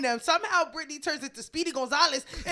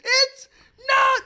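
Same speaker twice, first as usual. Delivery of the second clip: high loud crying wail; imitating a friend bawling in the street; mock sobbing; 9 semitones higher, 5 dB louder, much slower than usual. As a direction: sob out the words, hammed-up. Brittany crying.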